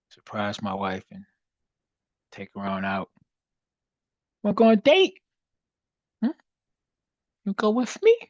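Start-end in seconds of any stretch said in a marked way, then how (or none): 4.4-8.2 high, loud put-on asking-out voice; playful date invitation. Streamer playfully asking wife out on a date, high and loud. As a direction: pitch it way up, mock courting.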